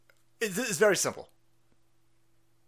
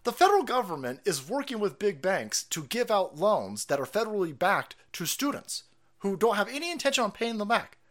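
ordinary talk

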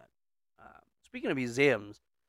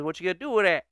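put-on higher Southern old-man question; Southern accent; 6 semitones higher, 4 dB louder, much faster than usual. Old man questioning the boy, Southern accent. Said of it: "why are they Southern?".

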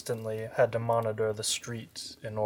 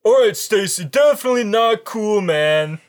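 put-on much higher, louder uneasy teen; nervous teen; 10 semitones higher, 13 dB louder than usual. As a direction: plead like a spooked teen. Voicing Todd.

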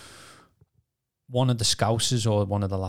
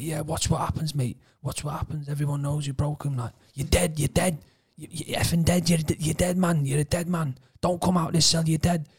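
higher-pitched, insistent mock threat; repeated menacing warnings, pitched up; acted-out threat; 7 semitones higher than usual. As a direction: imitate an inmate hammering home a threat. Cleaner's death threats acted out.

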